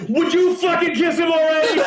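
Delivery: mock exasperated yell at the screen; exasperated outburst. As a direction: blurt it out in frustration. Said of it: "involuntarily, out of me".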